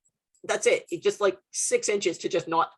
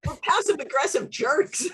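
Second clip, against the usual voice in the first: higher-pitched laughing retort; real laughter in a raised voice; 7 semitones higher than usual. Real, not acted. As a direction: laugh your way through the self-mockery.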